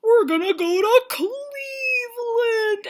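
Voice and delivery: high, slow fake wail; hammed-up tearful wail; mock crying. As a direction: whine it like a sobbing kid. Mock-crying over Cleveland trip.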